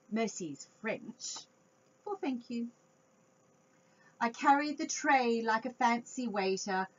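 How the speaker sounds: ordinary delivery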